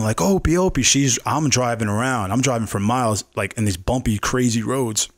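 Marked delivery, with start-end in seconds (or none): 0.2-0.8 higher, mild mock yell of the dog's name; imitating her calling out, half-hearted; retold calling out. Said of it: "like, screaming".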